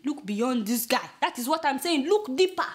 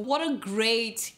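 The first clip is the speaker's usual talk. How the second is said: normal talking voice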